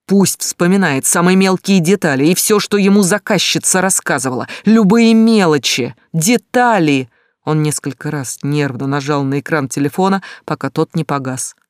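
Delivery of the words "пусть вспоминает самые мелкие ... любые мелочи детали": loud, high, urgent shouting; yelling into phone. Acted. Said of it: "орал в трубку". (кричит в трубку, требуя деталей)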